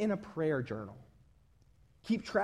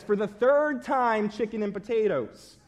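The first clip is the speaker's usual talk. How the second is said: loud, higher, exasperated retort; angry wife; 5 semitones higher, 7 dB louder than usual. Acted. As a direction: snap it as a fed-up wife. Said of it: "she is visibly angry".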